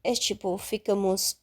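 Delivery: ordinary delivery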